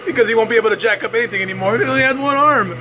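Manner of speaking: barely holding in laughter, rushing the punchline; laughing through words. Real, not acted. Cracks himself up at own pun.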